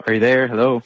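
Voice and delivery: ordinary talk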